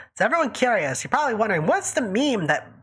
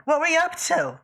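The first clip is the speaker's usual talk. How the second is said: squeaky gleeful gremlin giggle; 9 semitones higher than usual. Acted. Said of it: "What was that gremlin voice?".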